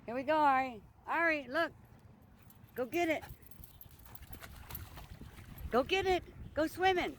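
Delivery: high, eager coaxing of a dog; excited dog talk. Real, not acted. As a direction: hype the dog up to fetch. High-pitched urging of the dog.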